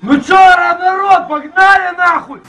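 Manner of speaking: loud, high, slow rallying yell; hype intro shout. Acted. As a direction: pump up the crowd at the start.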